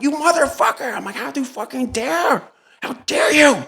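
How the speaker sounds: shrill rage close to tears; raging at him, voice cracking; furious, high and breaking; high enraged yelling. Retold or imitated. The confrontation at the door.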